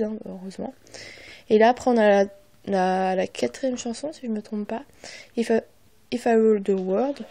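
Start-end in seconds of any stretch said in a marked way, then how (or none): 5.4-7.2 English title in a French accent. Real, not acted. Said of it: "Désolée pour mon accent". (anglais avec l'accent français)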